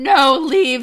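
loud, firm dismissal; interrupting. A narrator's reading. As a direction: break in with a forceful refusal. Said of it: "I interrupt him".

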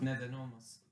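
distant, echoing voice; talking from the next room; from another room. Real, not acted.

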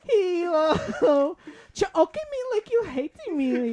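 high, loud, slow squeaky voice; reverse voice. Acted. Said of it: "What if it was the reverse".

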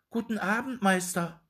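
high, polite little dwarf. A narrator's reading. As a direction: greet him in a high small voice. Dwarf greets the shoemaker. (hohe Zwergenstimme)

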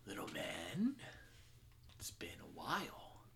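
soft, breathy, close menace; menacing whisper. Acted. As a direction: greet an old enemy quietly. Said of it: "is like".

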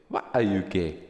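loud mock Ugandan accent; Ugandan interviewer meme imitation. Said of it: "such a meme".

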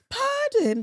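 high posh British "Pardon?"; a prim British lady; British accent. Retold or imitated. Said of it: "She's British".

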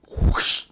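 loud breathy whoosh; imitated whoosh. Retelling a car backing into a mailbox, imitated whoosh. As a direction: whoosh it out sharply.

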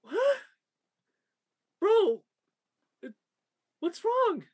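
high, incredulous what; be totally confused by the fuss; baffled disbelief. Retold or imitated. Crew member baffled by the crying client, high disbelief. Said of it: "all you can do is just go".

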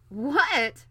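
loud, high, astonished outburst; indignant shout of surprise; exclaimed. A narrator's reading. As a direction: burst out in royal disbelief. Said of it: "exclaimed the king".

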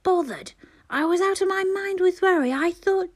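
high, loud, indignant burst; furious outburst. A narrator's reading. Mother rounding on the neighbour, furious outburst. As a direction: explode at him in disbelief. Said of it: "look of pure fury".

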